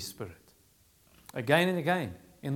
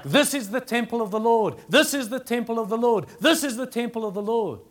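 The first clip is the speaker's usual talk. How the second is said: each line higher and louder, stress on This; emphatic repetition; 6 semitones higher, 4 dB louder than usual. Shown as a demonstration. Preacher quoting Jeremiah for emphasis, This stressed high and loud. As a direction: hammer This home on every repeat. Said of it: "It's trying to emphasize".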